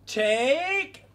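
loud, high attack cry; battle shout. Pretend. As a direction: throw the line with the punch. Attacking in the game.

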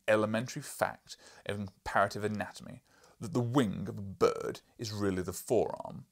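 loud, booming scornful lecture; scornful lecturing professor. Acted. Professor lecturing the journalist, loud and scornful. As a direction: talk down to him like a dull pupil.

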